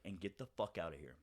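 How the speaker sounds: low, soft, controlled dismissal; quiet, cold retold order; very calm sociopath. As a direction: drop it calmly, like a sociopath. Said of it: "very calmly, like, sociopath".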